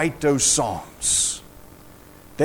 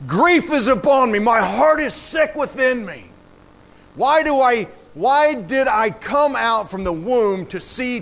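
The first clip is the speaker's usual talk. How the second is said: dramatic scripture reading; 5 semitones higher than usual. Acted. Preacher quoting a Bible writer whose joy is gone, anguished lament. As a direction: cry out the grief, then let it subside.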